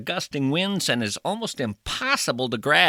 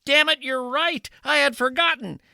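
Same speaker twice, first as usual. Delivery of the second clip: much higher, flustered; high Revere voice; 11 semitones higher than usual. Acted. Revere realizing he forgot the midnight ride, high flustered voice. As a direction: pitch way up, caught off guard. Comic voicing of Paul Revere.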